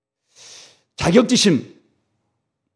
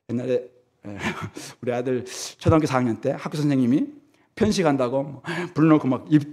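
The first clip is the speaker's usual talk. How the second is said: ordinary talk, nothing marked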